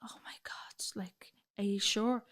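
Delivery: hushed, near-whispered shock, slowing into the question; retold stunned disbelief. Partner stunned by the diagnosis.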